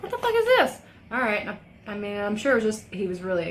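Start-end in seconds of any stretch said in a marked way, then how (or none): 0.0-0.8 high-pitched mock disbelief; retold yelp of disbelief, pitch jumping way up; incredulous outburst. Retelling own shocked morning reaction.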